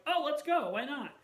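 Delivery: high, loud burst easing on the end; retold eager agreement. Daughter recalling last-minute tickets with mom, playfully eager. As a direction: re-enact a spur-of-the-moment yes. Retelling spontaneous decision with mom.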